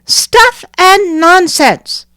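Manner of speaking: put-on high, slow, dismissive child voice; scornful little girl. Nanina to the crowd, high slow scornful child voice. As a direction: brush it off like a proud child. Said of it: "said Nanina".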